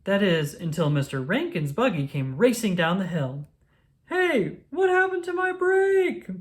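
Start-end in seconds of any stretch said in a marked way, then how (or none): 4.1-6.3 high, loud cry of alarm; alarmed shout. A narrator's reading. Mister Rankin shouts as his buggy races off without brakes, alarmed. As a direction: yell after the runaway buggy. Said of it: "Mister Rankin shouted".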